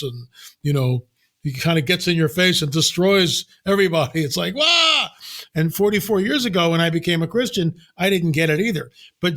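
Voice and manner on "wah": a sudden high yelped "Wah!" like God lunging at you; mock divine roar. Wrathful God in your face, high mock shout.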